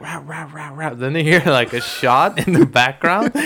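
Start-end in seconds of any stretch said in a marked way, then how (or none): 0.0-0.9 high, very soft distant barks; imitated dog barking. Dog barking before the gunshot, imitated barks. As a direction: yap quietly like a far-away dog. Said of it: "the dog stops barking".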